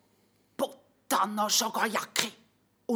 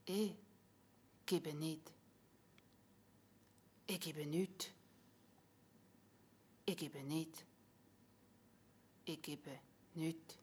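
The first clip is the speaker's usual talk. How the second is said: soft, low, fading refusal; fading dying voice; 8 dB quieter than usual. Acted. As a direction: let the life drain from each repeat.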